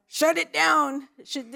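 normal talking voice

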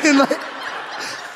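breathy giggle. Real, not acted. Laughs at own story.

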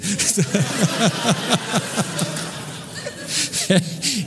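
high burst of helpless laughter; real laugh. Real, not acted. Pastor laughing as he retells the caller's apology, real laughter.

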